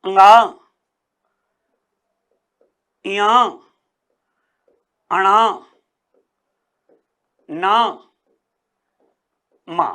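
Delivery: अंगा, इया with long pauses; teacher spaces out the nasals; nasal demo. Shown as a demonstration. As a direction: sound out अंगा इया अणा ना मा one by one. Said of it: "प्रत्येक वर्ग का पंचम वर्ण".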